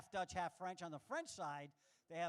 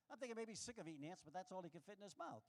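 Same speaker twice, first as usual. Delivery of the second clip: ordinary talk, nothing marked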